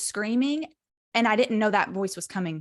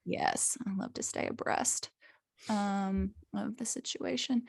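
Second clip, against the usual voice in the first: cheeky whisper; 8 dB quieter than usual. Pretend. Whispered joke on abreast.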